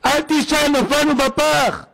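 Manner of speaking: high, drawn-out panicked cry; alarmed. Retold or imitated. Frightened young man warning his father, high alarmed cry. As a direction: call out in acted alarm. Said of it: "נבהל".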